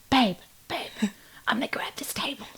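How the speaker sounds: whispered imitation of an urgent partner; hushed retold whisper. Partner quietly claiming a free table, hushed whisper.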